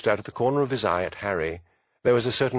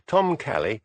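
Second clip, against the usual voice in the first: usual speaking voice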